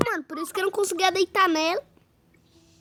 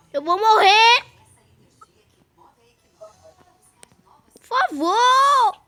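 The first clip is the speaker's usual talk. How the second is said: panicking gamer; slower than usual. Real, not acted. Gamer about to die, begging.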